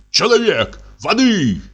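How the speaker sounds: high cries for a servant; shouting. Acted. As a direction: call out for water in alarm. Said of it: "Кричит".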